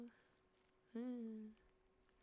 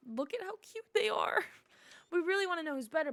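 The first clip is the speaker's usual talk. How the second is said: higher and louder, bubbly; eager little creature; cute critter voice; 6 semitones higher, 9 dB louder than usual. Acted. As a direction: gush like an excited little animal. Critters chatter in dialogue.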